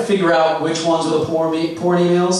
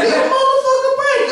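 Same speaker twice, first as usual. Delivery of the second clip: shrill falsetto plea; very high squeaky voice for the roommate; 3 dB louder than usual. Retold or imitated. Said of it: "I'm going to do his set for you right now, verbatim".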